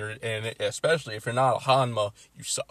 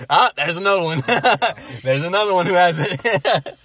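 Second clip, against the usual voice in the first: laughing loudly through words; 5 semitones higher, 11 dB louder than usual. Real, not acted. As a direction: say it while cracking up. Laughs while remembering.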